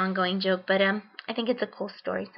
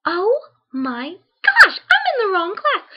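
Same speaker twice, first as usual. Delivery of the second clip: much higher, louder, slow stretched gasp of shock; panicked realisation; 10 semitones higher, 10 dB louder than usual. Retold or imitated. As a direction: alarm bells going off. Realises she is in wrong class.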